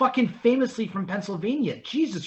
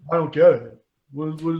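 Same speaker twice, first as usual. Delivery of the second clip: put-on a lower-pitched, dim Baltimore accent; mock Dundalk local; 6 semitones lower than usual. Mocking Dundalk locals online, in a thick dumb accent. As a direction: play a humorless local who misses the joke. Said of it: "dumb Dundalk fucks who were like".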